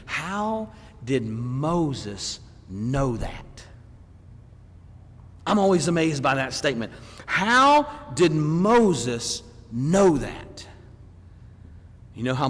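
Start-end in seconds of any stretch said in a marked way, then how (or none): 0.1-3.6 very slow and low, word by word; drawn-out "How", then space out each word softly; slow wondering question. Real, not acted. 7.3-10.7 very slow, punched word by word; deliberate preacher's question at full voice; slow emphatic question. Real, not acted. Repeats the question with more force.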